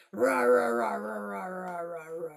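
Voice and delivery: fading tiger roars; roar demo. Shown as a demonstration. Storyteller agreeing with child and roaring, fading. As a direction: roar then let it fade away. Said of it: "You're right".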